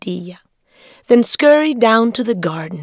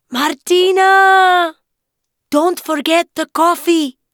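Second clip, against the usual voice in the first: calling out; 10 semitones higher, 4 dB louder than usual. Acted. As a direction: call her back from the house. Abuela calling from afar.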